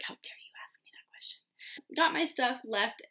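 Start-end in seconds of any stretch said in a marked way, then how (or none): 0.0-1.4 fast, hushed, theatrical whisper; softer, rushed whispered indignation; mock dramatic whisper. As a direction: whisper it like a wounded diva. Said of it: "I was like".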